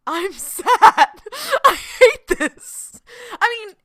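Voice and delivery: loud, high laughing lament; giggling I hate this. Real, not acted. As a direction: laugh through a mock wail of despair. Laughing about feeling sad.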